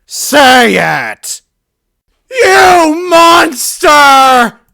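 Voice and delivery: very high, loud, slow sobbing scream; a shrieking cry of rage; screaming through tears. Acted. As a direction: scream it with everything. Shouting, crying out.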